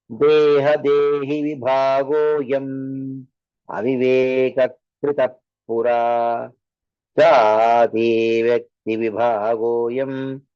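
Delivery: slow, low chanted Sanskrit verse; a teacher reciting scripture before explaining it; verse recitation. Acted. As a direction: intone the shloka slowly and deliberately.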